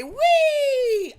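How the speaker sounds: high, loud, giddy squeal; mock silly squeal. Man mocks viral TikTok clips, high mock squeal. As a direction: squeal like a viral attention-seeker. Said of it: "and say".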